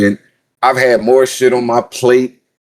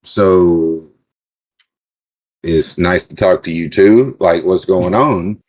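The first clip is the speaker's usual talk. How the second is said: lower, slow, deadpan sarcastic calm; mock calm retort; 4 semitones lower, slower than usual. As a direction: play it cool and sarcastic, slowed right down. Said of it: "I was like".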